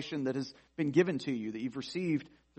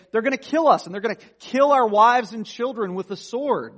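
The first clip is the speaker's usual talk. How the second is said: high, loud wail of doom; voicing the frightened crowd, much higher and louder; panicked lament; 7 semitones higher, 10 dB louder than usual. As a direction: cry out as terrified Israelites. Israelites lamenting in quoted scripture.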